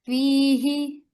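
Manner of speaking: slow, drawn-out tajweed chant; Quran recitation. Shown as a demonstration. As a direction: chant each letter slowly for the student to repeat. (धीमी तजवीद वाली तिलावत)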